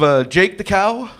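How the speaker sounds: ordinary talk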